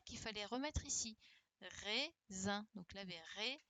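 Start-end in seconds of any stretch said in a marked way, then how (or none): 1.8-2.7 "raisin" in two separate beats, "rai... sin"; split into syllables. Shown as a demonstration. Teacher reviewing the syllables for pupils, "raisin" split in two. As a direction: say "raisin" with a pause between syllables. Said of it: "il fallait utiliser les syllabes".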